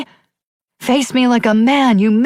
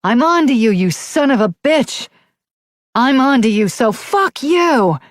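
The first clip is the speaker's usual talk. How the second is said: furious shout; 10 semitones higher than usual. A narrator's reading. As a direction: hurl it up at the sky, enraged. Shouting at the night sky.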